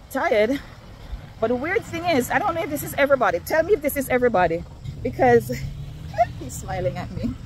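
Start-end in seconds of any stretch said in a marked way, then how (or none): 5.6-6.3 high breathy giggle; a shy little giggle. Real, not acted. Giggles about man smiling.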